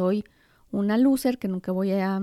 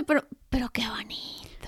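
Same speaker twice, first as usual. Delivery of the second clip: susurro; 6 dB quieter than usual. Real, not acted. Conmovida por lo dicho.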